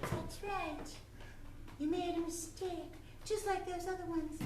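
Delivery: high, soft rabbit voice; menacing little rabbit. Acted. Rabbit threatening intruding detective, softly menacing. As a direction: quietly threaten as a small rabbit.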